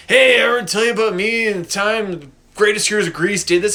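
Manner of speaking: loud, high put-on old-timer voice; rambling old man. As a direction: ham up a decrepit has-been retelling his glory days. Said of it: "Shut up, old man, you're creepy".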